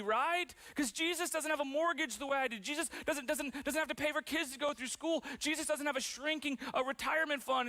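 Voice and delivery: high-pitched, exasperated objection; mock protest. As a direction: act out an incredulous listener protesting at a high pitch. Voicing a sceptical listener's protest.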